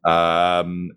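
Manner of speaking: normal talking voice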